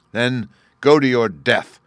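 loud, slow death sentence; booming warrior king. A narrator's reading. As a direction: hurl the sentence at him. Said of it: "cried the king".

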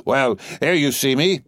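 usual speaking voice

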